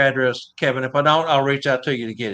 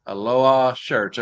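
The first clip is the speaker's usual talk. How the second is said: ordinary delivery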